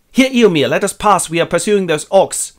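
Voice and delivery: high, loud acted appeal; urgent plea. Aragorn held up by riders, loud urgent call. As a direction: call out to be let through.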